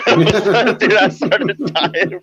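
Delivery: laughing through words; cracking up mid-sentence. Real, not acted. Laughter while retelling.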